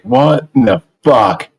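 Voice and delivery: ordinary talk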